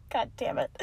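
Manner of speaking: breathy whispered "God damn it" under a laugh; whispered curse. Real, not acted. Laughing aside after mistake.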